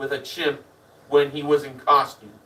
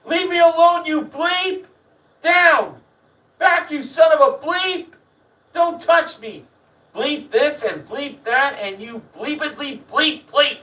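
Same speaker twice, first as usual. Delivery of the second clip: high yelling tirade, acted out; 10 semitones higher than usual. Man chased into the theater by a chimp, imitated yelling. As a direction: yell as you flee, bleeping every curse. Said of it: "he's yelling the whole time".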